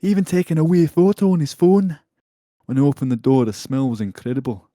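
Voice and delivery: loud, high mock Scottish; attempted Scottish accent. Host reads out the angry complaint in a loud, hammed-up Scottish accent. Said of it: "Scottish thing to say".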